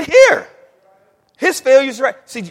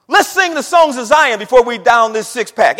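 mock pious suggestion; 3 semitones higher, 10 dB louder than usual. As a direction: play the goody-goody nobody listens to. Mocking churchy buddy among friends.

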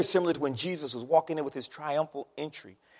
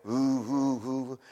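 imitating spinning pottery wheel; 4 semitones lower, much slower than usual.

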